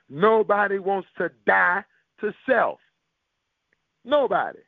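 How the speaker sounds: normal talking voice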